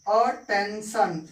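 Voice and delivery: Attention drawn out slowly, a-ten-shan; slow demo word. Shown as a demonstration. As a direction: model attention slowly for students to copy. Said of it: "एक example हम देखते हैं".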